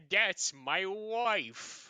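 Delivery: drawn-out, very slow "my wife"; slow mock claim. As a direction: stretch it out proudly. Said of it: "And then I said".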